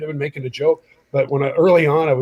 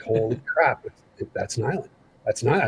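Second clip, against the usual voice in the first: low, quiet inner voice; hushed awe; 4 semitones lower, 5 dB quieter than usual. Retold or imitated. Twelve-year-old fan spotting his hero, hushed awe. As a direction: murmur it to yourself, a little starstruck.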